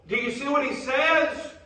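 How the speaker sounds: loud, high-pitched preaching shout; fired-up preacher hollering to the church; rousing pulpit cry. Real, not acted. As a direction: call the congregation to attention at full force.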